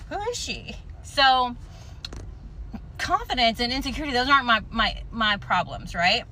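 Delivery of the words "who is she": high, soft mock-amazed question; mock awed self-admiration. To her own mirror reflection, a higher, softer mock-awed question. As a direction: gasp it like you can't believe how good you look. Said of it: "I look in the mirror and I'm like".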